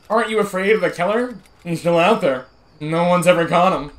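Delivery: put-on high, loud nagging kid voice; a hint of the annoying Sheldon kid; annoying young Sheldon voice. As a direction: pester him like a nerdy boy. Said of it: "the most annoying young Sheldon voice ever".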